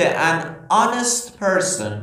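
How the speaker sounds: reads an honest person slowly; slow example read. Shown as a demonstration. Teacher reading the example slowly to students. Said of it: "صدای اولش چیه؟".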